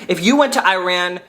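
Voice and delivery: ordinary delivery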